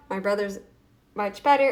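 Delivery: usual speaking voice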